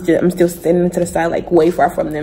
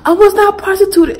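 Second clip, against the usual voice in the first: high, loud indignant protest; talking back; 12 semitones higher, 5 dB louder than usual. Retold or imitated. Daughter talking back to her dad, high indignant protest. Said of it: "I was like".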